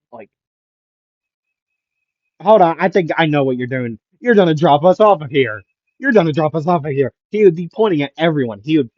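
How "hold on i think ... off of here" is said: higher, louder, quickening protest; agitated accusation. Acted. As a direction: call them out in mild alarm. Acted accusation at teacher.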